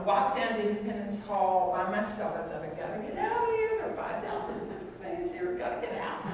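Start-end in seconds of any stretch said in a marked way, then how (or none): 2.6-6.2 high-pitched comic panic; mock desperation. Speaker jokes to audience about fleeing the convention, mock desperation. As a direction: squeal it like a half-joking escape plea.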